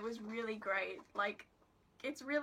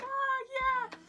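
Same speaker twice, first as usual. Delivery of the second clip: imitated high, louder cheer; delighted reaction; 8 dB louder than usual. Imitating the pair's reaction to the friend's mask, delighted. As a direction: cry out high as if thrilled by a surprise. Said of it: "their reaction was like".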